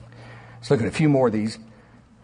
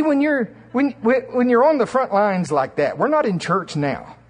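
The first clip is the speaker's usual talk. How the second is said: usual speaking voice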